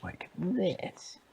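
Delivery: high witch demo; high-pitched storybook witch. Shown as a demonstration. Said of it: "talk like a witch".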